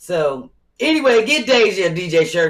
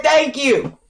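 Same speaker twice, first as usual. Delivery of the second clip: mocking thank you; 9 semitones higher, 6 dB louder than usual. Mocking the canvasser's reply.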